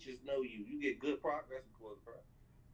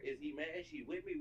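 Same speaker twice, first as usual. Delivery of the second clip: ordinary delivery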